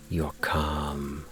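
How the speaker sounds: plain reading voice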